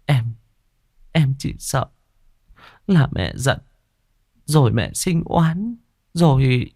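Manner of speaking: high, halting, tearful confession; choked-up wife voicing her fear; tearful worry. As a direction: falter anxiously in a high voice. Weeping wife confesses fear to husband.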